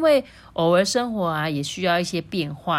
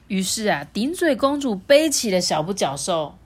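usual speaking voice

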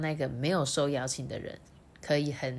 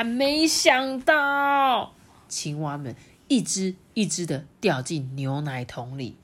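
usual speaking voice

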